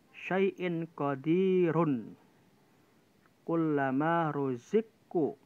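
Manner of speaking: syai'in qadirun drawn out very slowly, ro heavy; heavy ro recitation. Shown as a demonstration. Teacher reciting heavy ro examples for students, slow. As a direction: recite slowly, giving each ro a thick, dark sound. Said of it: "Maka dibaca tebal".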